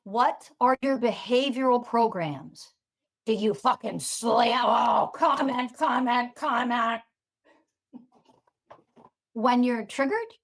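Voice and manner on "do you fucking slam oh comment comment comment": slowed, drawn-out mock chant; mocking commenters. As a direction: imitate compulsive commenting, each repeat dragged out alike. Mimics frantic triggered commenters.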